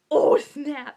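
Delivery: sudden very loud 'oh, snap'; explosive retold exclamation of shock; shocked outburst. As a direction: blurt it out loudly in mock shock. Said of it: "put my hand over my mouth".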